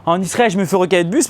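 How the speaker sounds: ordinary delivery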